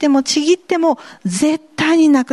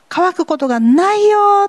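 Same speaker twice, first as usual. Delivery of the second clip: raised, louder voice for Jesus; Jesus's gentle promise; 9 semitones higher, 5 dB louder than usual. Acted. Preacher voicing Jesus's promise to the congregation, raised and louder. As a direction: lift the promise high and clear.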